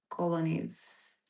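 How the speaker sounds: ordinary delivery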